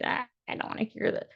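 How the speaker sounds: quick, breathy voiceless whisper; fast exasperated whisper. Retold or imitated. Retelling the urge to shut out her boyfriend, fast irritated whisper. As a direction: hiss it under your breath, annoyed and fast. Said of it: "I wanted to be like".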